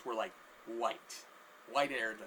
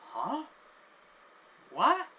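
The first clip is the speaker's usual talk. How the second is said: high, sharply rising questions; baffled questioning; 11 semitones higher, 9 dB louder than usual. Acted. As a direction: ask in utter confusion, pitch shooting up. Guy baffled by the meow.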